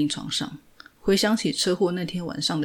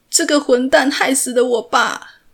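higher and much louder, stress on 蛋; desperate shout; 8 semitones higher, 10 dB louder than usual. A narrator's reading. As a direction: repeat it louder, pleading to be understood. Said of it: "他又说了一遍". (更大声地哭喊)

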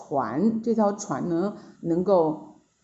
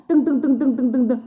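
rapid pattering syllables, pitched up; imitated footsteps; 5 semitones higher, 4 dB louder, faster than usual. Storyteller mimics the monkey hopping along the bridge, pattering. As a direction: patter out quick, even steps.

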